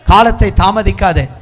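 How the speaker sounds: high preaching that keeps building; urgent exhortation. Real, not acted. Preacher urging youth to repent and prepare, climbing insistence. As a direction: drive each command harder to a peak.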